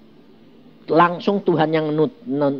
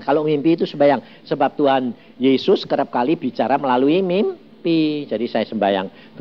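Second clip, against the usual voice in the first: normal talking voice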